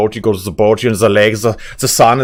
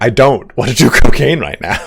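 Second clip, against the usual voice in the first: louder, laughing through the line; laughing refusal; 2 semitones higher, 5 dB louder than usual. Retold or imitated. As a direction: turn it down while cracking up. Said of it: "I'm like".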